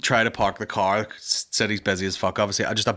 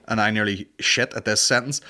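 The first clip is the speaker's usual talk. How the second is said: ordinary delivery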